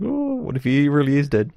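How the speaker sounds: high, drawn-in "oh" of dawning alarm; hammed-up anxious wondering; mock dramatic worry. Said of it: "that bit of dramatic tension".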